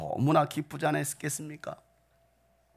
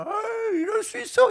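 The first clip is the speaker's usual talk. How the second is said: much higher, mock surprised squeak. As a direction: squeal it like a startled onlooker. Mocking reaction in sermon. (높은 목소리로 놀란 척)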